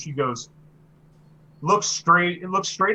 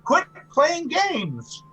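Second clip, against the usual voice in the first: put-on cranky old woman telling off a youngster; 12 semitones higher, 4 dB louder than usual. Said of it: "she sounds old".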